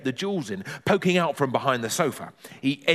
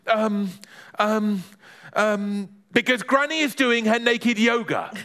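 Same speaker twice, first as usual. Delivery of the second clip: high hesitant ums, then a blurt; boy stalling, high-pitched; desperate excuse; 8 semitones higher than usual. Acted. Boy stalls neighbour at the door, high flustered blurt.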